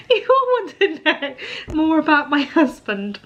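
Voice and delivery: tearful laugh, half crying; crying and laughing at once; real tears with a laugh; laughing through tears. Real, not acted. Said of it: "I'm full on crying".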